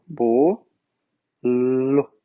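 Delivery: bo, pause, then lo with a held L; syllable by syllable. Shown as a demonstration. Teacher splitting a word into sounds, bo and lo isolated. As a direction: sound out bo and lo separately, low. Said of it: "o O fechado".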